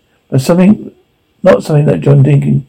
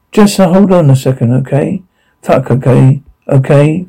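usual speaking voice

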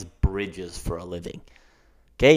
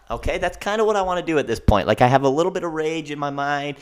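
usual speaking voice

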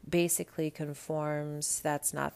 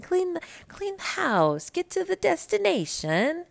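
mock sing-song; 13 semitones higher, 4 dB louder than usual. Real, not acted. List of chores, voice jumps.

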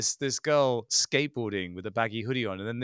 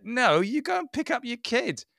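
high-pitched incredulous retort; mock outraged objection; exasperated; 13 semitones higher, 3 dB louder than usual. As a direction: scold in disbelief, voice pitched up. Imagined retort to promo mother.

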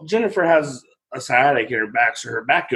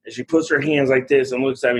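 ordinary talk

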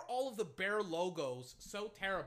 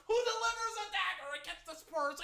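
anguished play call; 11 semitones higher than usual. Real, not acted. Fan calls a dagger against his team, anguished high cry. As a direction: pitch jumps way up. Dismayed call of opponent's three.

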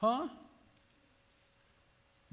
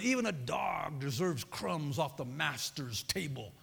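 low, soft, slow pleading answer; humble reply; 6 semitones lower, 5 dB quieter, slower than usual. Acted. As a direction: answer the master humbly and slowly. Woman's humble reply to the master.

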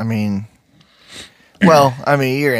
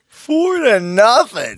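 slow disbelieving repeat, pitched up; incredulous, drawn out; 11 semitones higher, much slower than usual. Real, not acted. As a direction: stretch it out in disbelief.

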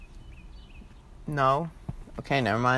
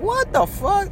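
squeaky, much higher mock outrage; mock disbelief; slower than usual. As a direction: jump way up in pitch like you can't believe it. Imitating his reaction to hosts.

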